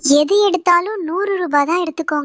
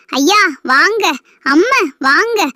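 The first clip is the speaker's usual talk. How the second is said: mocking the vendor's call; slower than usual. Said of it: "அப்படின்னுகிட்டு கணச்சிக்கிட்டு வந்துருதா".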